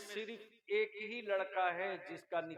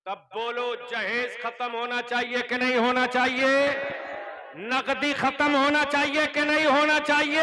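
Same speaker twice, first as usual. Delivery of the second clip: fiery preaching; 7 semitones higher, 11 dB louder than usual. Real, not acted. Cleric preaching against dowry to followers, thundering. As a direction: thunder it to the gathering.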